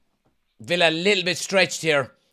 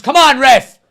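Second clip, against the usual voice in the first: loud exasperated appeal, high; pleading with the referee; 9 semitones higher, 14 dB louder than usual. Real, not acted. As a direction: shout your protest at the ref.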